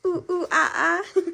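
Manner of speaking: high, loud playful monkey call; monkey sounds. Pretend. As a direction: hoot like a monkey for the toddler. Mom imitating monkey for toddler.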